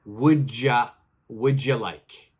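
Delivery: "Would ya" blended, "you" cut to "ya"; joined together. Shown as a demonstration. Teacher showing how she really says it, words joined. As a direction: run "would ya like" into one. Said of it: "the way I say it is".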